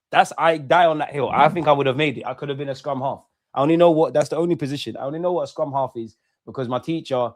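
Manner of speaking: ordinary talk, nothing marked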